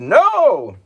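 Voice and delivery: sudden high shouted no. Acted. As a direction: explode with a furious no. King shouts refusal.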